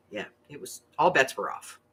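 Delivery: ordinary delivery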